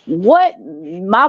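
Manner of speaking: ordinary talk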